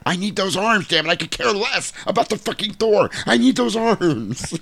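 desperate dad demanding the toy, shrieking; frothing mock rage. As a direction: rant like a crazed toy hunter. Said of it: "frothing at the lips".

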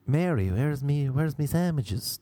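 high, hammed-up stage Irish; fake Irish accent. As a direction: show off an exaggerated Irish accent. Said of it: "These are my accents even".

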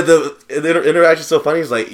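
ordinary delivery

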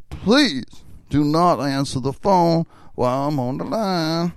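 slow, loud, official phone-company voice; Ray Otis-type character. Retold or imitated. Prank-calling his dorm buddy as a phone company worker, slow loud official voice. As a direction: announce it slowly and loudly like a serious utility worker. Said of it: "the character I did in Ray Otis kind of character".

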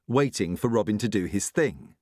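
usual speaking voice

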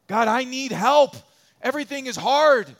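high, loud cry for help; desperate plea. Retold or imitated. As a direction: beg God as if at the end of your rope. Voicing a desperate prayer.

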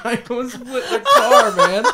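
words squeezed out through big laughs, high; laughing hard. Real, not acted. Laughing retelling the crash.

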